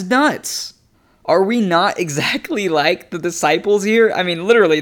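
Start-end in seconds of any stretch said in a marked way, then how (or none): none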